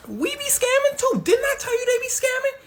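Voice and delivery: extremely high mock voice; squeaky confession. Nigerian government admits scamming to Super Cop, squealing. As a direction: squeal it out in falsetto.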